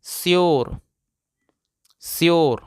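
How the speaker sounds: "श्योर" repeated as a model word, falling; teacher's repeat-after-me "श्योर"; pronunciation demo. Shown as a demonstration. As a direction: answer your own drill with a firm "श्योर". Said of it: "क्या पढ़ते हैं?".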